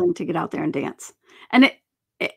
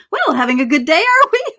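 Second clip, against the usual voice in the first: dry tease, rising sharply on "are we"; a mildly mocking professor's quip; wry professor teasing; 7 semitones higher, 4 dB louder than usual. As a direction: needle her about the pirouette. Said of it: "He's like".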